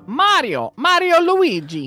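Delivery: cartoon Italian game-hero voice, drawn out; Mario. Dad claiming the Mario role, playful cartoon impression. Playing at being Mario and Luigi.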